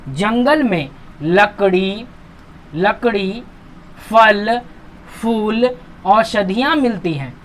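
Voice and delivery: slow list, a gap after each of लकड़ी, फल, फूल; short pause between items. Shown as a demonstration. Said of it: "हर जगह थोड़ा रुकना है".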